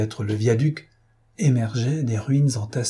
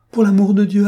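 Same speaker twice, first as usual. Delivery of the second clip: high, loud frantic plea; desperate plea; 9 semitones higher, 8 dB louder than usual. A narrator's reading. Fotheringay begs the thunder and lightning, frantic high plea. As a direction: beg the heavens in panic. Pleading with the storm.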